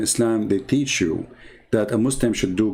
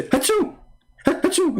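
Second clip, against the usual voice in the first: high squeaky fake sneeze; cartoonish pretend achoo; mock sneeze. Mock sneezes in Allah story.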